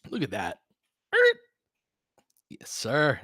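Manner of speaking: normal talking voice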